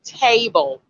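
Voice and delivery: table with a lengthened 'TAY'; long A sound. Shown as a demonstration. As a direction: stretch table's first vowel into 'ay'. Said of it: "the extra is the A sound".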